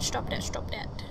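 urgent whispered stop that; tense real whisper. Real, not acted. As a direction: plead under your breath. Whispered pleading.

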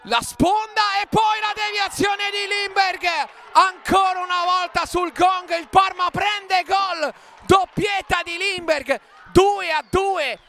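high breathless goal shout; breathless italian goal commentary. Real, not acted. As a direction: yell the equaliser breathlessly. Late equaliser called.

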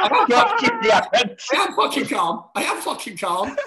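high, loud strained insistence; half-laughing fake calm outburst; mock angry protest. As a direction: insist you're calm while clearly not. Mocking being told to stay calm.

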